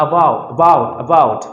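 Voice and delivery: "about" said firmly and loudly, opening on "a"; demonstrated opening "a". Shown as a demonstration. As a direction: sound out the first "a" of "about". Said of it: "আমি এখানে টান দিচ্ছি".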